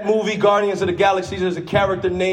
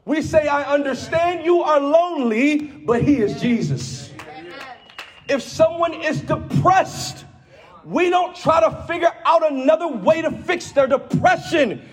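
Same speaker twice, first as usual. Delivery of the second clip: full-force preaching; 7 semitones higher than usual. Acted. Preacher to his church, calling out with full force. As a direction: preach it hard to the congregation.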